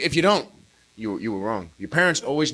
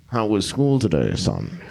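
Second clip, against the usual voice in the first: sly dad; 9 semitones lower, slower than usual. Retold or imitated. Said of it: "Dad starts asking questions".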